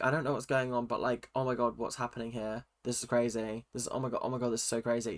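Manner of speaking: quiet, low mock bewilderment; clueless act. Imitating a player who feigns cluelessness, quiet mock bewilderment. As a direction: murmur it as if lost in the chaos. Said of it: "playing the sort of, like, card where it's sort of like".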